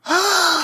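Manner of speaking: a high, sudden gasp of amazement; sharp gasp. Real, not acted. Co-host gasps at Fábio's hypnosis plan, amazed.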